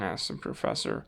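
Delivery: ordinary delivery, nothing marked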